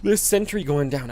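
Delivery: usual speaking voice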